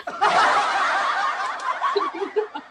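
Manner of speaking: high laughter. Real, not acted. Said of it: "I'm crying na".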